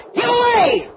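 sharp high yelled warning; a retold guard's yell, pitched high; mock yell. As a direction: bark it at the crowd. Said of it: "Everybody else yelled".

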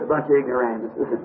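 a low, quiet put-down; scornful verdict. Retold or imitated. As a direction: let God dismiss them flatly, low. God's verdict on the church.

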